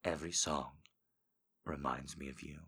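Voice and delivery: seductive voice. Pretend. Reading a viewer quote in a low sultry voice. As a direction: purr it low and intimate. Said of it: "I say it".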